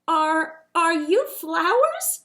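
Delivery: hesitant, stammered question, slow; stuttering. A narrator's reading. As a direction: stammer in shy wonder. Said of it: "he stuttered".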